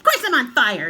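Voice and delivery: high, very loud retort; indignant yell. A narrator's reading. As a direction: yell it in outrage. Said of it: "she yelled".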